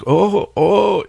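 high delighted gasp of surprise; sudden high startled oh; surprised. Retold or imitated. Excited reaction on meeting woman.

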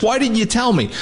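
imitating an exasperated detective, high pitch; exasperated reproach. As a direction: demand an explanation, voice jumping up. Retold detective reproaching the narrator.

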